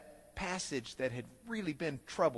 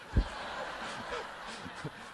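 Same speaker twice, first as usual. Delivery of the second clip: laugh; 4 semitones higher, 7 dB quieter than usual. Real, not acted. Laughs at being caught.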